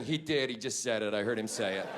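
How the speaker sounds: low, hushed passenger gossip; scandalised murmuring. Retold or imitated. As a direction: imitate shocked onlookers muttering quietly. Shocked passengers voiced among themselves.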